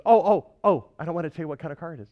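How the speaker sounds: loud, fast teasing "oh oh oh"; excited friend. Retold or imitated. Friend teasing on the phone about the car, excited and fast. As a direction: burst out gleefully, rushing the words.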